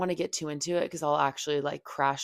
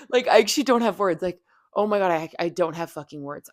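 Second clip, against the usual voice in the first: real exasperated outburst; 8 dB louder than usual. Real, not acted. Telling viewers about his lies, real exasperated outburst. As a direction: blurt it out, too angry for words. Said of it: "I'm so fucking mad again".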